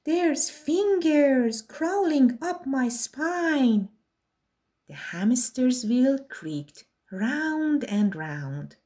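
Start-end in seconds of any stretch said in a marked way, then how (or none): 0.0-3.9 slow, higher whine; whining. Acted. Lanky Len spooked by something on his back, a slow whine. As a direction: whine slowly, spooked and squirming. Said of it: "Lanky Len began to whine".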